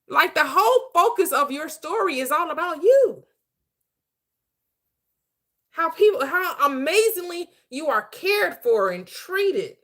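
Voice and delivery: high-pitched, emphatic pep talk; impassioned exhortation. Real, not acted. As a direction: urge it with rising fervor. Coach passionately urging listeners to self-love.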